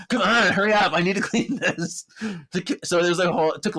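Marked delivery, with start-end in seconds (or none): 0.1-2.0 higher, louder pushy hurry; impatient urging. Retold or imitated. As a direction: hurry her along impatiently. Paige pushing Piper to finish.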